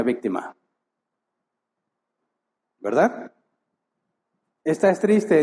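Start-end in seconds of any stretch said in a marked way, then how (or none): 4.6-5.4 mocking quote.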